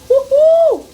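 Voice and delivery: high, loud whoop of joy; a happy, delighted woo-hoo; excited cheer. Real, not acted. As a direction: cheer it out like you're thrilled. Cheering while cooking.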